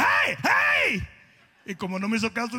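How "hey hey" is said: high shout at a horse; mock panicked shout to a bucking horse; retold yell. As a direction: rider yelling at the bucking horse. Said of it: "Y yo le dije".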